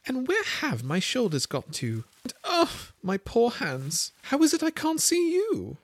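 high, near-crying dismay; alarmed Alice. A narrator's reading. Alice cannot find her hands, high and near tears. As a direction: wail it like a lost child. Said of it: "as she spoke".

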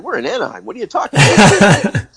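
mock incredulous outburst in a high voice; bewildered player. Acting out baffled player's protest.

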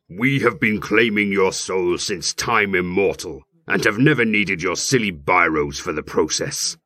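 low, slow demon voice; dragon voice. Acted. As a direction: speak as an ancient hellish creature. Demon speaks through him.

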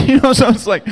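loud high laugh through the words; can't hold back the laughter. Real, not acted. Laughing at glass table.

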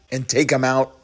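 normal talking voice

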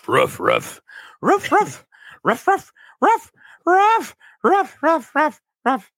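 high yapping barks, building then easing; mock dog barking. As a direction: bark like a little yappy dog, in mock. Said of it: "Andrew Tate, like, said".

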